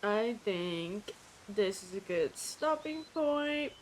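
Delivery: ordinary talk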